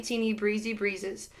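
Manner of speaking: usual speaking voice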